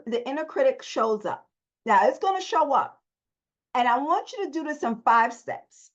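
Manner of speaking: normal talking voice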